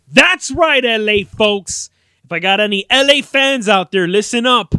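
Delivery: loud, high shout to fans; rally the local crowd; hyped call-out. Pretend. Vlogger calling out to L A fans, hyped shout.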